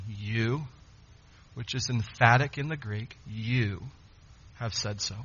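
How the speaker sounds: low-key and final, no argument; cut and dry. Retold or imitated. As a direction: state it plainly with weight on YOU. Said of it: "Very cut and dry".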